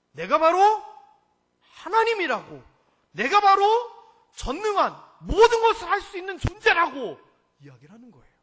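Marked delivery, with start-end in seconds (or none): 0.1-7.2 loud and higher, thundering; God roaring his own name; shouted proclamation. Acted. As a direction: hammer home who you are. God insisting loudly.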